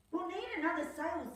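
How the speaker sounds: quick bright Nell; Nell's voice. Acted. Nell planning a new sail, high quick voice. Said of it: "said Nell".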